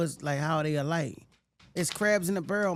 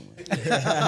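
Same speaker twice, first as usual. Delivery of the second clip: big, rolling laugh; real, unrestrained laughter; hearty laugh; 4 dB louder than usual. Real, not acted. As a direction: burst out laughing at your own joke. Laughs at own joke about the rapper.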